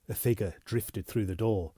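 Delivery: ordinary delivery, nothing marked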